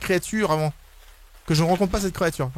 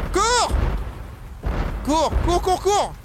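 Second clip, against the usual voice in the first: panicked shouting; 11 semitones higher than usual. Real, not acted. Gamer chased again by Siren Head, panicked high shouts. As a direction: scream for your life.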